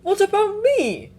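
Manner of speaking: high, whiny complaint; complaining. Acted. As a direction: complain like a put-out child. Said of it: "Pan complained".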